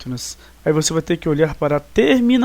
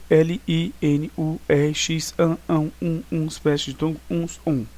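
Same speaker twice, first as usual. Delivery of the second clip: spelled letter by letter. Shown as a demonstration. Teacher unpacking the mnemonic for pupils, spelled letter by letter. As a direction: spell out the endings one item at a time. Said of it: "tendo de soletrar cada uma delas".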